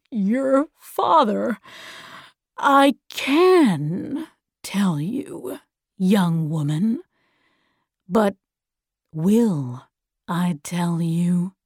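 slow, drawn-out teasing villain; taunting villain. Acted. The voice withholding news from a young woman, slow taunting villain.

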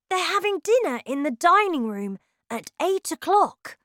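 put-on high, bright young boy voice; child voice. A narrator's reading. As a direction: voice the little boy lightly. Child mouse voice.

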